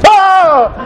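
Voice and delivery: high-pitched mock scream; startled scream. Priest seeing five guys with machetes, terrified scream. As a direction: yelp in comic terror.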